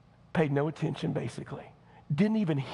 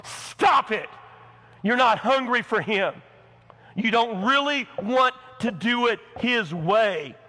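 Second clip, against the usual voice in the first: louder, higher scolding; stern rebuke; 6 semitones higher, 5 dB louder than usual. Acted. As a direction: call them out firmly. Preacher rebuking congregation.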